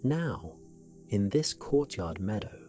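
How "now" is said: plain reading voice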